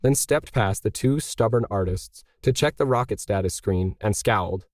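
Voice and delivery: ordinary delivery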